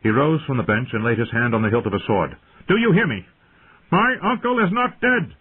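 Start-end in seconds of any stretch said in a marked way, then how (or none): 2.7-5.3 high, loud, furious insistence; ringing anger. A narrator's reading. Robb rising with hand on sword, ringing anger. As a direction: demand to be heard, voice raised high. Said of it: "His voice rang against the stone walls".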